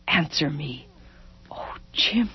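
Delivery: soft, spent whisper; giving in. A narrator's reading. Wife collapsing into her husband's embrace, softly giving in. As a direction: let the fight drain into breath.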